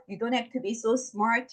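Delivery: ordinary talk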